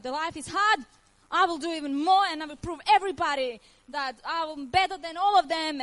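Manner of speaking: telling herself to fight on, loud and determined; defiant resolve. Retold or imitated. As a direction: fire it out like a vow. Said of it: "No, I was like".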